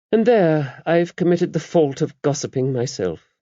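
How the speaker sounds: mother superior confiding in a priest; the abbess. A narrator's reading. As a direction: voice the abbess kindly and knowingly. Abbess voiced in the story.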